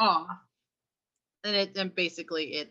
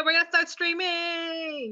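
excited announcement; 9 semitones higher, slower than usual. Real, not acted. Announcing Twitch.

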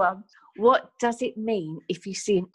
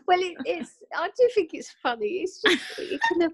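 laughing; 11 semitones higher than usual. Real, not acted. Mum laughing while answering.

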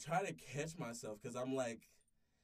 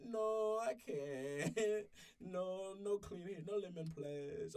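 higher mock Spanish-accented maid voice; hammed-up Consuela with a Spanish accent; Consuela impression; 8 semitones higher, 4 dB louder, much slower than usual. Mocking the doctor as Consuela, higher Spanish-accented voice. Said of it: "Like Consuela".

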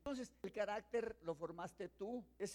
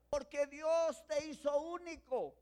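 ordinary delivery

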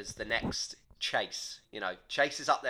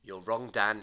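ordinary delivery

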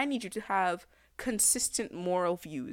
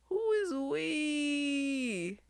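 slow drawn-out question; 6 semitones higher, much slower than usual. Real, not acted. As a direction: stretch every word, very slow. Repeating the outraged question.

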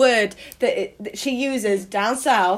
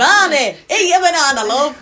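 louder, higher broad northern call; mock northern soap mum; 4 semitones higher, 6 dB louder than usual. Quoting Corrie character to friends, broad northern.

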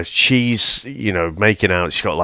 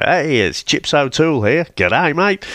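imitating an Englishman's Aussie accent, pitched up; higher-pitched put-on Australian greeting; Michael Caine's Aussie accent; 7 semitones higher than usual. Retelling a favourite film moment, mock Aussie accent. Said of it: "Michael Caine comes out with his Aussie accent".

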